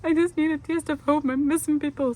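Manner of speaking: high, loud, whiny plea; mock desperate whine; mock pleading. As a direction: ham up needing home. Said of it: "I was like".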